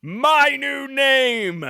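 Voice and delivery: slow, loud grand villain reveal; dramatic villain announcement. Retold or imitated. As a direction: proclaim it like a comic-book villain. Villain announcing his name.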